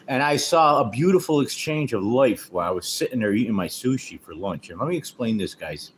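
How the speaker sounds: ordinary talk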